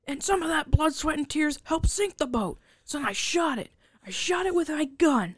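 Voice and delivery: a sulky sailor grumbling about his sunk boat; whiny Jake. Retold or imitated. Jake whining to Michael about sinking his boat, high and put-upon. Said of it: "he's like".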